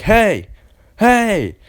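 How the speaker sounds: high, loud retold shout of hey; calling out hey. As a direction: yell it like a stranger calling after you. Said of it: "and he's like".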